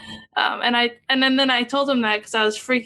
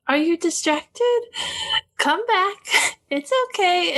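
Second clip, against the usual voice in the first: gentle coaxing; 7 semitones higher than usual. Retold or imitated. Gentle meditation guide voice quoted.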